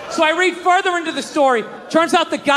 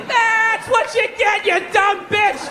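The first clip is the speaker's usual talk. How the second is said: mock screaming at screen; 8 semitones higher, slower than usual. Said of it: "Just screaming at my computer".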